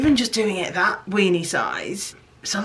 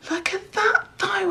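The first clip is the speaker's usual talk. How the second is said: squeaky high excitement sinking into a whisper; can't contain the thrill. Real, not acted. Artist showing off her melted crayon piece, high thrilled squeak.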